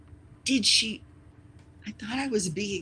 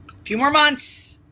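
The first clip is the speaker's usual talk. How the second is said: 1 emphatic; 9 semitones higher, 10 dB louder than usual. Real, not acted. Emphatic then repeated.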